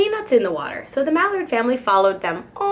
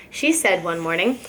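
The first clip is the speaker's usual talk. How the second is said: plain reading voice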